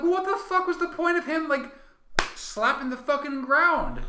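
high, loud, incredulous outburst; exasperated rant. Acted. Moviegoer questions the slapstick fall, high exasperated complaint. Acting out own exasperated reaction.

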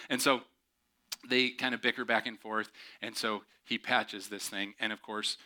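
usual speaking voice

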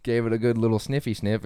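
ordinary talk, nothing marked

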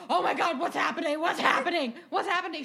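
loud, high dramatic panic; hammed-up alarmed dad; freaking out. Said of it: "freaking out 'cause he's like me".

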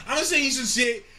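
normal talking voice

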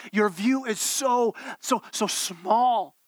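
SO stretched very slowly on each repeat; drawn-out so small. Acted. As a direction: draw out so, so, so to shrink the view.

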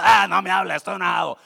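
loud, grumpy mock outburst; angry. Angry man brushing off his friends, loud mock anger. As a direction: shove the friend away with your voice. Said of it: "está enojado".

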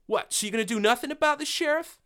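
high, fast, indignant woman's voice; wife rounding on the sheriff, high and furious; angry Laura. Acted. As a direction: demand it of the sheriff, outraged.

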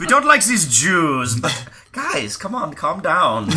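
high-pitched, hammed-up German accent; terrible German accent. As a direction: do a bad, comic German accent. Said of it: "It's a terrible German accent".

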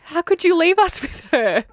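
high, loud, slow mock protest; mock outraged cry. Sisters protesting to parents, mock outraged high cry. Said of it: "we were like".

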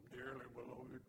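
mock church-steeple piety, low and understated; stuffy religious leader. Preacher mocking religious leaders who swallowed a church steeple, lightly pious voice. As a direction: lightly imitate a pompous, pious cleric. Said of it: "sounded like they had swallowed a church steeple".

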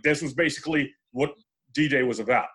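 ordinary talk, nothing marked

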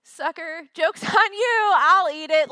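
high, loud, laughing gloat; gloating taunt. Retold or imitated. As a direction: crow over her like a winning kid. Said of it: "And I'd be like".

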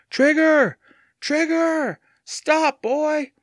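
very high, urgent calls; desperate shouting. A narrator's reading. Evan trying to stop the fast-growing dog, desperate shouting. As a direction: call desperately after the dog, pitch right up.

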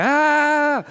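a playful dad's shout to drown out the crying; loud yell to out-shout a crying baby. Retold or imitated. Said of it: "he's crying, I'll go".

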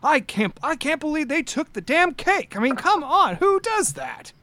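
put-on high, indignant old man ranting; fired-up old knight. Old knight Cecil fumes to coworkers about the stolen cake, high and indignant. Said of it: "he's just like".

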